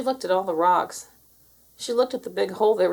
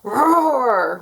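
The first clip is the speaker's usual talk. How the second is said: loud, drawn-out engine roar; tractor roar; 4 semitones higher, 7 dB louder than usual. A narrator's reading. Imitates tractor engine roaring.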